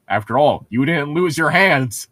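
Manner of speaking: usual speaking voice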